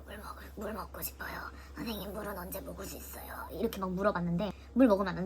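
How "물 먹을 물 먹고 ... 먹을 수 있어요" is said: voiceless, very soft pleading whisper. Retold or imitated. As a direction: beg for water in a bare whisper. Asking nurse with sore throat.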